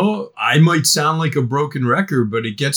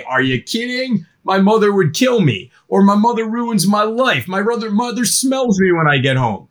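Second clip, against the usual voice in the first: put-on higher, teen groaning about mom; mock teen excuse; 6 semitones higher than usual. Teen dodging drugs at a party, mock exasperated. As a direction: play the put-upon teenager. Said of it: "normal in teen culture is to say".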